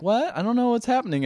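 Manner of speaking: confused woman. Retold or imitated. Retold confused reply to a come-on.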